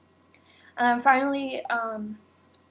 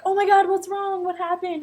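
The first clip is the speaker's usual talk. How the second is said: put-on worried boyfriend; 7 semitones higher, 4 dB louder than usual. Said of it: "And he's like".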